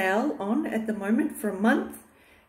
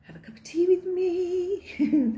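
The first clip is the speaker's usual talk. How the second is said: sing-song invite; 10 semitones higher than usual. Real, not acted. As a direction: sweetly invite them to tea.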